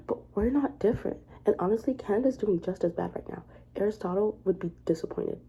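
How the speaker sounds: normal talking voice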